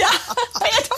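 laughing high through the name. Real, not acted. Laughing at Peter's reaction.

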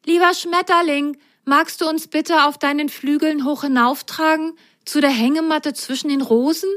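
calling to the sky. Acted. Rosenrot calls the butterfly from below, high and sweet. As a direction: call up politely to the butterfly. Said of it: "Rosenrot rief in den Himmel".

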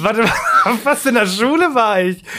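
talking through laughter; laughing. Real, not acted. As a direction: laugh while trying to remember. Laughing while recalling school.

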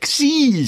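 "Gsi" thrown in high, stressed as past tense; emphatic correction. Real, not acted. Co-host corrects the jab about a big ego, emphatic "Gsi".

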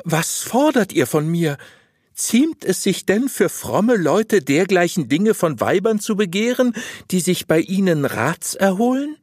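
high-pitched woman taken aback; high, affronted wife questioning her confessor; shocked, protesting wife. Acted. Voices the astonished wife protesting.

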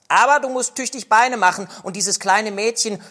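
put-on robber girl voice. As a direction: set the reindeer free boldly.

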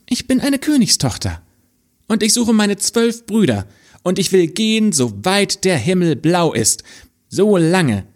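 put-on high, earnest young princess. The princess tells the boy she seeks her brothers, high voice. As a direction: lift the voice high for the king's daughter. Princess speaks in fairy tale.

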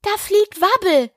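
high-pitched exclamation; excited crowd cry. A narrator's reading. The crowd sees Wabbel fly out, excited cry. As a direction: call it out like an onlooking chorus. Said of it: "hörte sie einen allgemeinen Chor".

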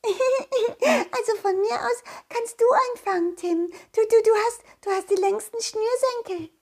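put-on high kid voice through laughter; a giggling child teasing; giggly child.

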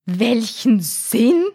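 slow, halting incredulous question; gasped disbelief. A narrator's reading. Father shark echoes his son, gasping in disbelief. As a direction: gasp it out, rising at the end. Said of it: "japste sein Vater".

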